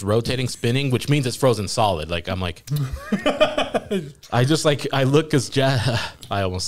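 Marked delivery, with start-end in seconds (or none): 2.7-4.1 high-pitched burst of laughter; real, helpless giggle. Real, not acted. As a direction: crack up mid-story. Teller cracks up at own story.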